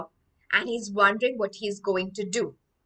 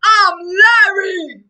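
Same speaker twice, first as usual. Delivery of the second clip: loud, raised, drawn-out announcer-like bellow; booming baby champion; 10 semitones higher, 13 dB louder, much slower than usual. Acted. Hypnotized baby revealing his past self, booming shout. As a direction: bellow it slowly like a boxing champ. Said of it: "it has a sonorous voice".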